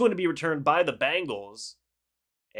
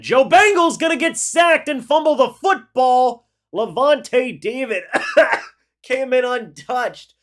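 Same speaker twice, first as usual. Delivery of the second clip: higher and louder, hyped call of the sack; excited sack call; 8 semitones higher, 9 dB louder than usual. Real, not acted. Streamer calling the sack of Joe Bengal live, hyped shout. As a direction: shout it like the sack just happened.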